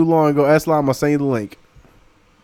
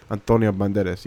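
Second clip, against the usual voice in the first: put-on Spanish accent; 8 semitones lower, 6 dB quieter than usual. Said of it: "say his name like that".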